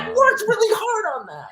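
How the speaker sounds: very high whiny complaint; hammed-up hurt whine; mock whine. Dad complaining his bracelet got lost, very high mock whine. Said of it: "I was like".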